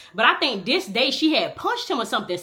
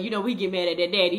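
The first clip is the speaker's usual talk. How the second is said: usual speaking voice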